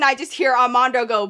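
usual speaking voice

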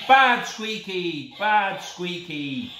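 loud, slow scolding of a toy dog; hammed-up stern telling-off; mock telling-off. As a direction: shout at him like a naughty puppy. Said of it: "if you shout at Squeaky and tell him off".

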